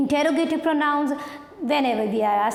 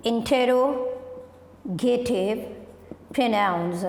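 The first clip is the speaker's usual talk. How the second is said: "interrogative pronouns" drawn out very slowly with a pause between words; slow dictation; much slower than usual. Shown as a demonstration. Teacher writing the heading "interrogative pronouns" on the board, slow dictation.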